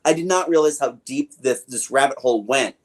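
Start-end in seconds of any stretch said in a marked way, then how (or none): none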